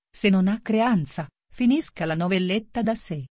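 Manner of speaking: ordinary delivery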